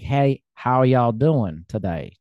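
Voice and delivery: slow, stretched Southern American greeting; Southern drawl. Retold or imitated. Explaining regional accents to a British guest, slow Southern drawl. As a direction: lean into a lazy Southern hello. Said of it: "like, a Southern drawl".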